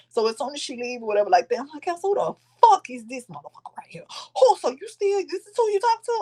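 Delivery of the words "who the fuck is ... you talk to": retold indignation. Retold confrontation over the ex.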